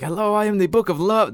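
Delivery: high, put-on character accent; Book of Love. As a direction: introduce yourself as a cartoonish accented talking book. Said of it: "That's his accent".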